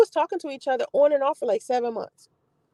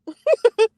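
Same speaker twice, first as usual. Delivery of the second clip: high laugh; 10 semitones higher, 5 dB louder than usual. Real, not acted. Laughing after dismissing guy.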